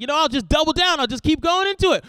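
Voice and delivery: high, loud burst of resolve; eager doubling down. Retold or imitated. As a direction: plough on, acting over-keen. Retold resolve to keep explaining.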